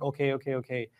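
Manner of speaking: soft, low, placating repetition; disarming, non-aggressive. Shown as a demonstration. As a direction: back off gently, voice dropping. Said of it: "I'm not being aggressive".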